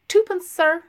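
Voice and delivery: put-on bright high shop girl, a touch bold; daring shop girl. A narrator's reading. Shop girl asking a gent for his twopence, high and bold. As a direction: pipe up high and a little bold. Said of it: "the shop girl dared to say".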